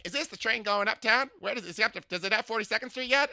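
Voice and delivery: high, loud, sing-song camp voice; imitating a flamboyant older man. To a stranger on the subway, gushing camp impression. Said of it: "I imitate people".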